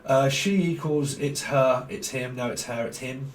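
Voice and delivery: ordinary delivery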